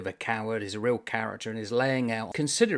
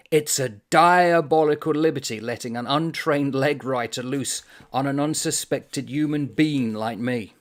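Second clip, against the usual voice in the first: louder, aggrieved declaration; indignant protest; 3 semitones higher, 6 dB louder than usual. Acted. The Irishman protesting to the author, loud and indignant. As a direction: protest like a wronged man.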